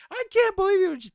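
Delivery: very high-pitched mock outrage; imitating angry wife. Pranked wife confronts her husband, imitated high scolding. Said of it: "she comes in and yells at me".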